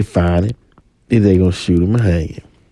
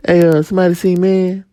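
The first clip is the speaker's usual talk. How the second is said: higher, casual asking around; higher voiced guy asking after his partner; street guy asking; 9 semitones higher than usual. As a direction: call out to the block.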